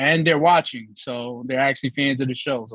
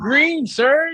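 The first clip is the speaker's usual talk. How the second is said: ordinary talk, nothing marked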